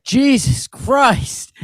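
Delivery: high, drawn-out New York heckler shout; exaggerated old-time New York accent; old New York dude. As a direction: yell it slowly like an old New Yorker. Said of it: "like an old, like, New York dude".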